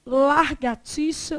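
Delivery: "Largatixa" slow, with an r moved into the first syllable, LAR; popular mispronunciation. Shown as a demonstration. Teacher showing a common error, slow exaggerated mispronunciation. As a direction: draw out "Largatixa" with its misplaced r. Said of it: "Largatixa também".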